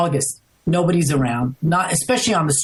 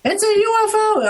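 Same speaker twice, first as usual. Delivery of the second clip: retold scream. On the balcony, seeing the UFO overhead: high-pitched mock scream. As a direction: squeal it out high like reliving the shock. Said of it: "I'm screaming".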